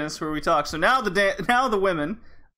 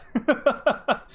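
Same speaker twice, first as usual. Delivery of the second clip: laugh; 10 semitones higher, 3 dB louder than usual. Real, not acted. Laughing at the Canadian bit.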